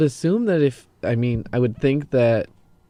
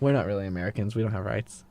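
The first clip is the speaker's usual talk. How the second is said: dry sarcastic murmur; quiet deadpan sarcasm; 3 semitones lower, 7 dB quieter, faster than usual. Acted. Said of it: "I was being sarcastic".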